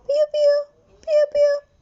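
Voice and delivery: very high, loud, squeaky chick peeps "piu, piu"; chick chirps. Shown as a demonstration. Teacher showing the chick's call, very high chirping. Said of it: "Cochuauche e yurú".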